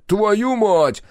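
high, loud angry curse; high-pitched burst of anger; angry exclamation. A narrator's reading. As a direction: yell the curse from another room. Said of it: "раздался гневный возглас".

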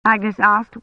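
plain reading voice

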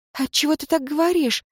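high astonished wife. A narrator's reading. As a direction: ask it in amazement. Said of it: "изумилась Лейра Фойер".

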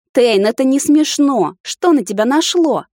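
high, loud, drawn-out remonstrance; exasperated protest. A narrator's reading. Woman remonstrating with her jealous captor, high exasperated voice. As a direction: plead indignantly for him to see sense. Protesting while being carried off.

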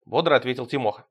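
plain reading voice

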